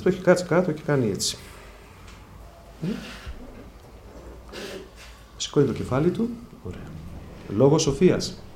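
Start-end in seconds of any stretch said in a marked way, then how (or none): none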